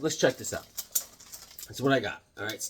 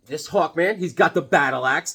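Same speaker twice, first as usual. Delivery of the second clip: ordinary talk, nothing marked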